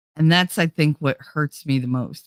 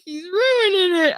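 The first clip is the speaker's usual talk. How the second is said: mock whining; 13 semitones higher than usual. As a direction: draw it out high like a mock wail. Imitates own upset complaint.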